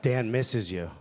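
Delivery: low, sultry love-song DJ; late-night radio dedication. Acted. DJ reading a listener's love letter, low and sweet. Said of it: "Light F M letters".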